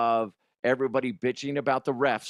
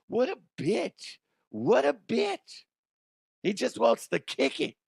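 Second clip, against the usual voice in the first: high-pitched indignant mock whine; mock outrage; 10 semitones higher than usual. Siding with Charlie Brown over the pulled ball, mock outrage.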